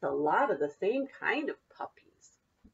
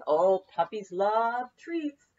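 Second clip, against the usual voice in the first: ordinary delivery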